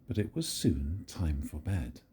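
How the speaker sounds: usual speaking voice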